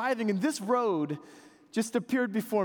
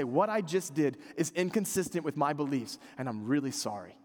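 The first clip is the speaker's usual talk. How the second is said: sincere apology; 6 semitones lower than usual. Shown as a demonstration. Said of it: "You go up to somebody and you say".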